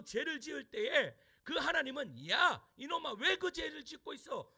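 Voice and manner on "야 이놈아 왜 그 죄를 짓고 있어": scolding shout. Retold or imitated. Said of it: "라고 혼내시는 하나님이".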